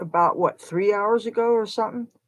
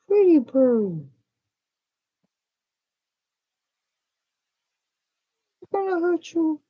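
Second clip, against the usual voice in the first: soothing a scared bird; 8 semitones higher than usual. Real, not acted. Soothing a frightened baby bird.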